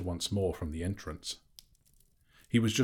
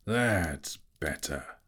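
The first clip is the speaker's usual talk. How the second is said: dragon voice; 2 semitones lower, much slower than usual. A narrator's reading. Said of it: "said Smaug".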